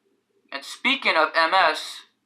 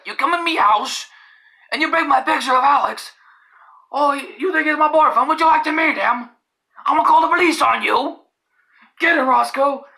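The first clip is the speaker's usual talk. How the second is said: high, put-on ranting voice; pitched-up, worked-up impression; Dohop; 10 semitones higher, 4 dB louder than usual. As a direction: imitate an indignant character making threats. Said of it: "I think I'm gonna sound like Dohop".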